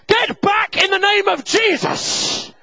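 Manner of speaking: high, loud, drawn-out holy rebuke; mock-heroic preacher's shout; shouted command. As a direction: bellow it like an exorcism. Imagined shouted command to crowd.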